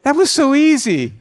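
high, loud mock amazement; amazed relief. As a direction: exclaim in comic disbelief. Retelling his delighted amazement.

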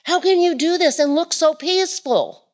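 high, wondering question to a suffering friend; marvelling onlooker, pitched high; amazed onlooker. Retold or imitated. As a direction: ask in disbelief how they stay calm. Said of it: "you go".

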